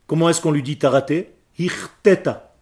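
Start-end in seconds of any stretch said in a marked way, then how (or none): none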